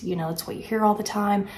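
ordinary talk

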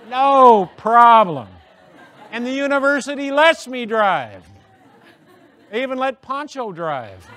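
high, loud, slow laughing sarcasm; deadpan joke breaking into laughter; mock-earnest boast, laughing and high; sarcastic laughing. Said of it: "So I say".

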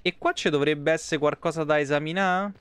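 normal talking voice

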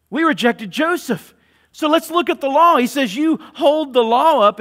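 high, loud, impassioned preaching of Stephen's rebuke to the council; preaching at full force. Acted. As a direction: thunder it from the pulpit.

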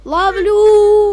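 loud excited yell; triumphant shout. Real, not acted. Triumphant catch in game.

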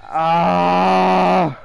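loud, slow pained groan. Real, not acted. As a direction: drag it out, still hurting. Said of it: "Why does it hurt that bad?".

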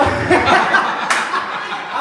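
high, breathless laugh. Real, not acted. Preacher laughing after his joke to the congregation.

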